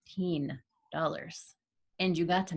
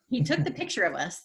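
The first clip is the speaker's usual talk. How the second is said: normal talking voice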